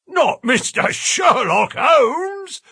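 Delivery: high, slow prize-fighter's roar; astonished roar. A narrator's reading. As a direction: roar the name in amazement, drawn out. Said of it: "roared the prize fighter".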